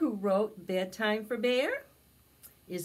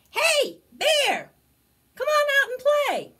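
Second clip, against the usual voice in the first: very high, loud critter calls; squeaky little friends calling out; 10 dB louder than usual. Acted. Friends beg Bear to come out, high and loud.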